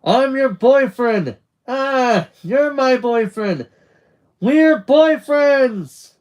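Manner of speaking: high, loud, elated yelling; whooping boyfriend announcement at full voice; screaming. A narrator's reading. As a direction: shout it while running along the beach. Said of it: "Charlie just screams as Nick runs, saying".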